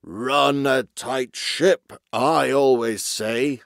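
put-on slow, loud, pompous old-boss voice; grunting old chief. A narrator's reading. Old walrus chief approving Basil's excuses, slow grunting voice. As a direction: stretch it out with self-satisfaction. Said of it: "the old man grunted".

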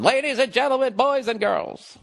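mock ringmaster introducing badminton; circus ringmaster. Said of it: "in a three-ring circus going".

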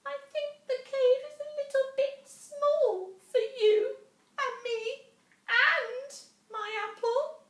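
very high, loud nervous squeaky voice; backing away from the bear; frightened mouse. A narrator's reading.

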